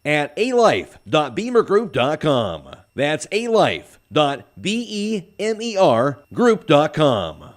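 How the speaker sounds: radio commercial tag-out; ad read. Acted. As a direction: spell out the URL for listeners. Announcer reads sponsor ad.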